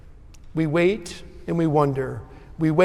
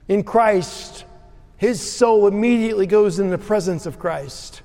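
loud, higher-pitched preaching; preaching at full force; 6 semitones higher, 5 dB louder than usual. Acted. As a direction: proclaim it boldly to the whole congregation. Pastor preaching to congregation.

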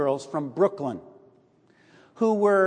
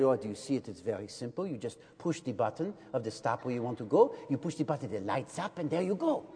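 much lower, much softer, smooth condescending guide; posing as a local; 7 semitones lower, 8 dB quieter than usual. Pretend. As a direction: coolly explain like a patient Parisian.

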